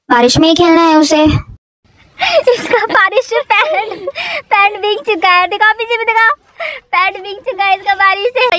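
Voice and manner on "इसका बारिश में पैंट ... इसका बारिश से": high-pitched, giggly teasing; tickled, higher and lighter voice; amused. Real, not acted. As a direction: laugh as you point out the wet pants. Teasing child about rain-soaked pants.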